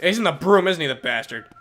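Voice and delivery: usual speaking voice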